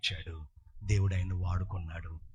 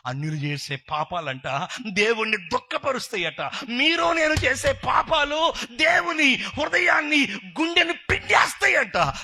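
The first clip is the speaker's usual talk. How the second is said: preaching, building up; 8 dB louder than usual. Real, not acted. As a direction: rise into a high, loud sermon shout. Preacher builds to full force. (స్వరం పెంచుతూ ఉద్వేగంగా ప్రసంగం)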